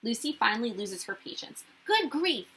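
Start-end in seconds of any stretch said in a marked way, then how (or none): 1.9-2.5 higher, exasperated outburst; exasperated cry. Acted. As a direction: snap out of patience at last. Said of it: "she cries".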